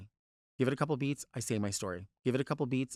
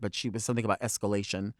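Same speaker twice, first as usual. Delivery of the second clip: normal talking voice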